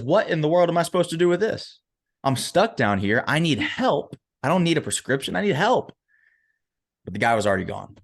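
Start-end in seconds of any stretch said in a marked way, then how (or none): 0.0-5.9 acted exasperation, pitch jumping up; exasperated plea. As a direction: plead in frustration, as a man stuck. Acted exasperated man stuck in hole.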